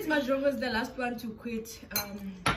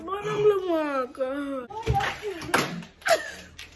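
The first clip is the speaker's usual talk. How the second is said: laugh-cry; 5 semitones higher than usual. Real, not acted. Laugh-cry from spicy pain.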